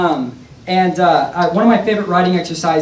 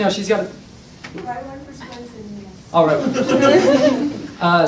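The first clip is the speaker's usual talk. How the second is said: ordinary talk, nothing marked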